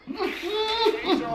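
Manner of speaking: high laugh fading out quietly; soft high laugh. Real, not acted. Finishing laughing at his cellmate's story, soft high laugh. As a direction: let the laugh trail off softly.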